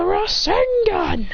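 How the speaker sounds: long, high attack call; slow mock anime shout; battle cry. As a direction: drag out the move name, pitched high. Attack called out in fight.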